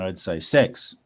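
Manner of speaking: Australian accent on "sex" for six; Aussie accent. Shown as a demonstration. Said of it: "if I was going to say it with an Aussie accent".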